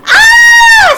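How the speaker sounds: high, very loud scream. Acted. Annie spotting the monster, loud scream. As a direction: shriek in fright. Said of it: "screamed Annie".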